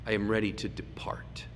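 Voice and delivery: hushed, breathy prayer to the Lord; reverent whisper. Acted. Simeon speaks up to heaven.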